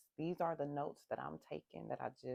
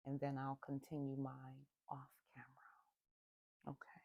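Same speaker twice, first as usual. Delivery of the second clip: whispering; 6 dB quieter than usual. Real, not acted. Aside before doing breaths alone.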